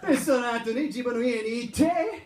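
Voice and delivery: put-on Japanese with a thick Canadian accent, "eh" up front; mock Canadian speaking Japanese, laughing. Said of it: "sound like a Canadian".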